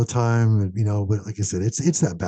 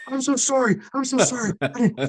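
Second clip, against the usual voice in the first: very high, mock-frantic pleading; retold panic, pitched way up; panicked apology. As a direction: squeal an embarrassed apology. Acting out his panicked apology.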